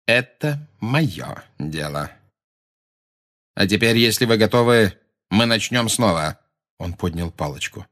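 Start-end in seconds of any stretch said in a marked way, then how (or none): 0.1-6.4 cold Snape voice. A narrator's reading. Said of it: "сказал он".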